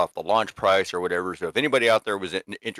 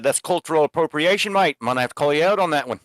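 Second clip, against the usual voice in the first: higher, a light Aussie scold; mock Australian accent; 8 semitones higher, 4 dB louder than usual. Adam jokingly calls out the host in a light Aussie accent. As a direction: tease him in a put-on Aussie voice. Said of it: "I said".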